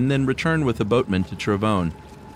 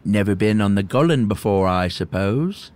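put-on creaky old hermit. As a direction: play the awkward old hermit. Voices the hermit.

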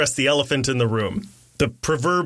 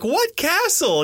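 high, slow bewildered what; baffled mock question; 12 semitones higher, slower than usual. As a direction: drag out the confusion. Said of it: "And I'm like".

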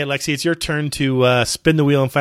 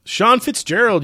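higher, louder winner reveal; hyped giveaway announcement; 10 semitones higher, 3 dB louder, slower than usual. Real, not acted.

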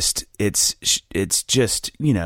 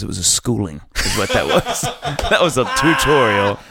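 laughing boast. Real, not acted. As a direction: crack up while bragging about the schooling. Laughing at his own story.

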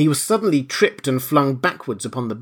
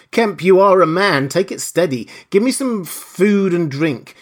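urgent appeal; 7 semitones higher, 5 dB louder than usual. A narrator's reading. Wounded man appeals to Kemp.

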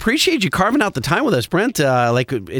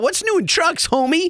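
excited; 13 semitones higher, slower than usual. Real, not acted. Cueing his segment.

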